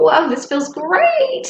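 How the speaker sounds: high, slow glowing whoa; savouring the hug; delighted thought. Retold or imitated. Hugged by her old church friend, delighted. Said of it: "And I thought".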